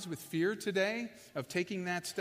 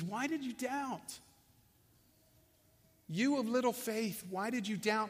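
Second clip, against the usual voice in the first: compassionate; 2 semitones higher than usual. Acted. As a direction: ask it tenderly, like a friend who forgives. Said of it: "with all empathy and all compassion".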